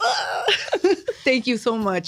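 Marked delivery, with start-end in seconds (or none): 0.0-0.4 high-pitched, laughing mock cry; half-laughing, high little wail; mock tearful wail. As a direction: playfully pretend to burst into tears. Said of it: "I told you I'm a cry".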